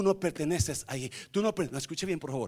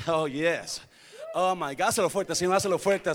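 usual speaking voice

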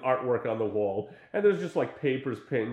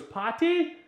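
squeaky invite. Retold or imitated. Roommate points to party shirt.

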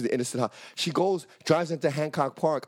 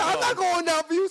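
high, frantic retold cry; comic high-pitched protest; panicked refusal; 13 semitones higher, 3 dB louder than usual. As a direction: act out bailing in a panic. Refusing blame while fleeing.

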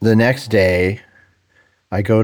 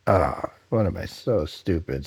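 retold sinking regret, quiet and drawn out; self-reproach; 8 dB quieter than usual. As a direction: kick yourself under your breath. Kicks himself after blurting out.

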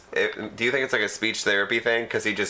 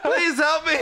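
high, laughing fake plea; mock plea for help. Joking about a man standing too close, mock begging for help. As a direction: beg for help in jest, pitched way up.

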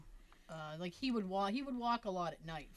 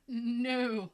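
drawn-out, higher, louder no; emphatic mock refusal; 4 semitones higher, 7 dB louder than usual. Co-host recalling the narrow staircase, mock reluctant refusal. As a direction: balk with a long no.

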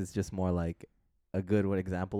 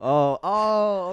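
surprised oh; 12 semitones higher, 8 dB louder, much slower than usual. Real, not acted. Reacting to story.

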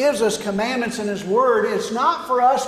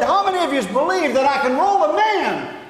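raised-pitch showman's challenge; calling out to a crowd; 4 semitones higher than usual. Retold or imitated. Tightrope walker challenging the watching crowd, calling out. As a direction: throw the question out to a whole crowd.

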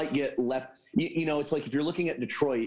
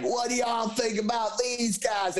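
high, slow slurred Southern drawl; mock drunk Southern accent, pitched high and stretched out; drunk Southern impression; 8 semitones higher, slower than usual. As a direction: imitate a tipsy Southern quarterback at a parade. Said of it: "drunk and Southern".

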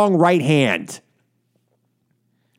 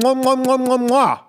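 mock kisses; 6 semitones higher than usual. Host mocking a president kissing up with smooches. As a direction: blow fawning kisses in mockery.

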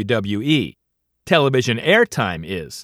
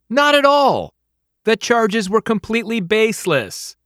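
mock indignant denial; 7 semitones higher than usual.

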